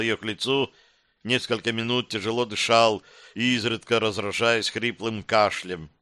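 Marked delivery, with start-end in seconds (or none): none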